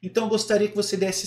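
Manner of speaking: ordinary talk, nothing marked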